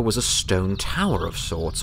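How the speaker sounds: usual speaking voice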